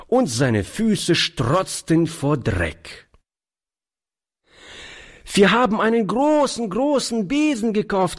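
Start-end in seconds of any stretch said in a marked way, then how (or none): 5.3-8.2 put-on much higher, fast eager child; high excited child voice; excited little boy. A narrator's reading. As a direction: announce it proudly like a small boy. Little boy Toni announcing proudly.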